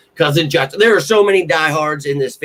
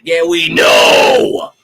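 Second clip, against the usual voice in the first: much lower, loud, slow shout; fed-up retort; 8 dB louder, much slower than usual. Real, not acted. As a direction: bellow it slow and deep.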